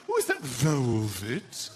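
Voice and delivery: slow, drawn-out velvet admiration; admiring wife. Acted. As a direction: linger slowly over the question. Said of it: "My wife does it too".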